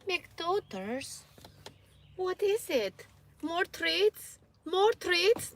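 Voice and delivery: very high cooing questions; pet talk voice. Acted. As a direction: coo at the dogs for treats. High coaxing talk to dogs.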